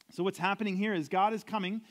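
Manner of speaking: usual speaking voice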